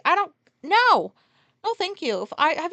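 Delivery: loud, high disgusted refusal. Real, not acted. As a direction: recoil and shout no, thank you. Disgusted refusal of potato eyes.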